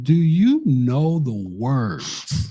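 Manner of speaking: "you" punched, whole question very slow; drawn-out challenge. Retold or imitated. Host retells challenging a dad on the song words, drawn-out challenge. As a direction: stretch it out slowly, leaning hard on "you".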